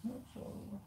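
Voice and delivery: quiet, hushed comfort; soft consoling. Real, not acted. Comforting his crying girlfriend, hushed and gentle. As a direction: speak softly close to her.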